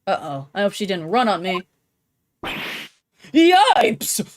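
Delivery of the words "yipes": high startled squeal of yipes; a sudden frightened girlish yelp; squeaky yelp. Acted. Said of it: "Really? Yipes?".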